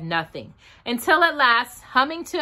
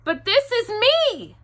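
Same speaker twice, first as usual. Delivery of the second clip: high, surprised bear voice; 3 dB louder than usual. A narrator's reading. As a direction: say it high, caught off guard. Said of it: "very much surprised".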